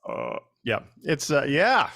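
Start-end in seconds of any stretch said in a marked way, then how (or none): 0.1-0.8 put-on low, halting old senator voice; slow, confused, trailing off; Mitch McConnell. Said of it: "you also do Mitch McConnell".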